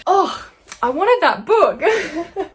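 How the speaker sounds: high, slow, drawn-out moan of disappointment; whiny lament. Real, not acted. Lamenting the delayed book.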